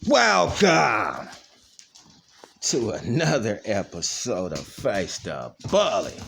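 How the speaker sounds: opening line of his dog show; show intro. Acted. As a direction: announce the show like a host. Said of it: "we gotta tell 'em".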